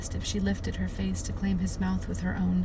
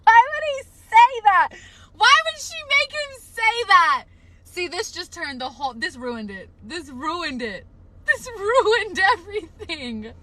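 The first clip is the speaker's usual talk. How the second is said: high dramatic wail; 11 semitones higher, 6 dB louder than usual. Real, not acted. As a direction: wail in hammed-up distress. Wailing over book line.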